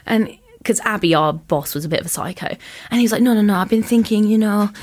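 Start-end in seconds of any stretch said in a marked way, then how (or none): 3.2-4.7 lightly put-on foreign accent; earnest retold plea, foreign accent; imitated non-English accent. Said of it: "I love the accent".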